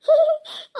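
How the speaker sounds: bursts into high loud laughter; a big delighted laugh at the joke. Acted. Daughter laughs at father's joke. (ಜೋರಾಗಿ ನಗು)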